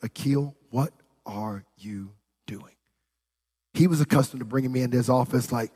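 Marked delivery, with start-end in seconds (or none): 0.0-2.7 hushed disbelief. Retold or imitated. Regional director to employee in trouble, hushed disbelief. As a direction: imitate a boss's slow, hushed reproach. Boss confronts him in retold story.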